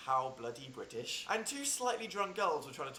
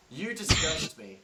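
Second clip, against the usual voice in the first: disgusted snap; 4 semitones higher, 8 dB louder, slower than usual. Acted. Stranger asks for change.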